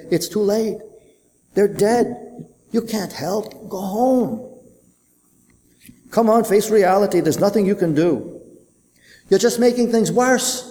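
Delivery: a touch of frantic parents; pleading parents. Acted. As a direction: beg him to give up and go home. Other parents pulling him away.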